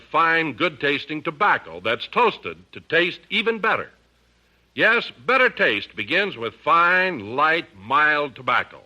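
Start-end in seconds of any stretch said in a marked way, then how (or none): none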